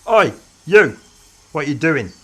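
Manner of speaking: sharp shout across the slope; calling out. A narrator's reading. Doctor calling out to a woman at the tardis, loud. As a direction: call out to a stranger. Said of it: "the doctor called out".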